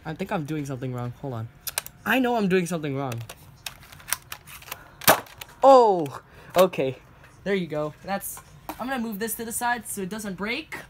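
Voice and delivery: normal talking voice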